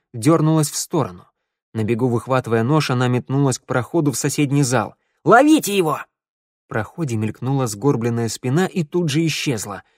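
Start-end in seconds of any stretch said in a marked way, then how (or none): 5.2-6.0 high, loud alarm call; urgent shout. A narrator's reading. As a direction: yell for the others to give chase. Shout to catch the fugitive. (громко, тревожный крик)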